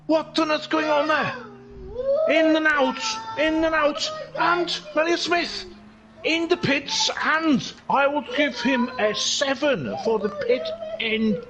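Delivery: high, excited Welsh commentary; Welsh accent. Pretend. Commentator following Phil's overtake, excited Welsh accent. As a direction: call the overtake in lilting Welsh. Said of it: "this is an accent I've been doing".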